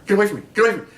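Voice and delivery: mock dismissal. Winners brush off second-place team.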